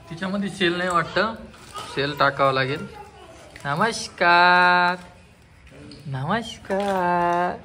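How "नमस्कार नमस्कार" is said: very slow, stretched-out hello; long, sustained calling greeting; drawn-out greeting. Real, not acted. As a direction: hold the last vowel long. Greeting called to a child.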